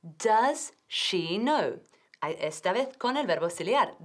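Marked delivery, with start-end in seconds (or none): 0.0-1.8 "Does she know?" stretched out very slowly; slow classroom demo; very slow model question. Shown as a demonstration. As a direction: drag each word out for learners. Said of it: "ahora vamos a ver la frase entera".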